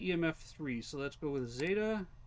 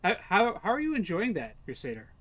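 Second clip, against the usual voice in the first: loud greeting; 4 semitones higher, 8 dB louder than usual. Real, not acted. Shouting a greeting to teammate.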